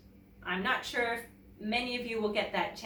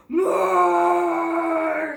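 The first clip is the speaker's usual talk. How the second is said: huge, loud roaring monster voice; dinosaur roar; 9 semitones higher, 12 dB louder than usual. A narrator's reading. Snow dinosaur demanding more food, roaring. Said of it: "she roars".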